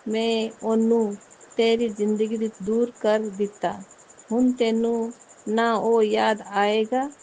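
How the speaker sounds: reading the guru's message aloud, slow and solemn. Retold or imitated. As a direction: deliver the guru's words slowly and solemnly. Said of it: "एक message था".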